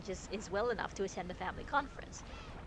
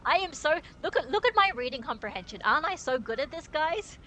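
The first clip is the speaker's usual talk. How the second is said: loud, high mock self-praise; sarcastic bragging; 7 semitones higher, 7 dB louder than usual. Real, not acted. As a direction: brag about it ironically. Mocking own reading comprehension.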